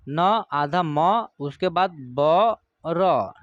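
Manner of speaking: Number spelled slowly, part by part; teacher spelling number for pupils, very slow. Shown as a demonstration. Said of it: "देखिए".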